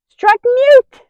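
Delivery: loud, high-pitched repeat for emphasis; dramatic, high outburst; emphatic exclamation. Acted. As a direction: jump way up in pitch and volume. Repeats the angel's punishment for emphasis.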